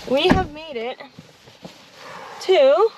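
proud arrival call; excited announcement. Real, not acted. Arriving, cheering the trip.

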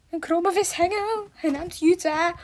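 high, slow mock announcer; mock announcement. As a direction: announce it grandly. Dramatische aankondiging. (hoge, trage spotaankondiging)

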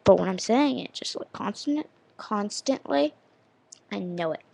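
ordinary delivery

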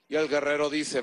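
usual speaking voice

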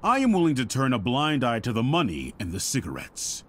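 ordinary talk, nothing marked

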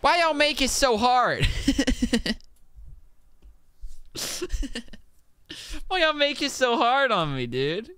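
hammed-up squeaky grievance; whiny complaint. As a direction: whine like a put-upon kid. Frustrated whining at game.

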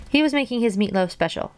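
ordinary delivery

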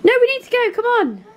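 high, rushed come on, come on; urgent hurrying. Real, not acted. Mum hurrying the kids out the door, high and urgent.

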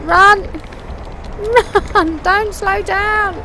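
loud, high yells urging the horse on; yelling at horse. Real, not acted. Rider yelling at her slowing horse, loud and high. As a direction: shout it like the race depends on it.

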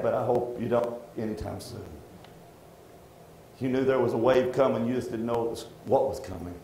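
low, subdued reflection; sombre, softening as it goes; heavy-hearted. Real, not acted. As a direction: speak low, under a weight. Recalls heaviness before bad news.

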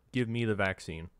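ordinary talk

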